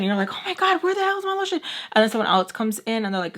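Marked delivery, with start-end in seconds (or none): 0.3-1.6 high-pitched mock panic; acted panic. Searching a room for lost lotion, mock panicked. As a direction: jump way up high in a hammed-up panic.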